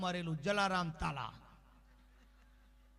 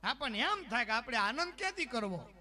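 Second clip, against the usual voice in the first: normal talking voice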